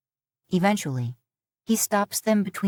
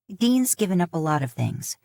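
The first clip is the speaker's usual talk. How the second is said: ordinary delivery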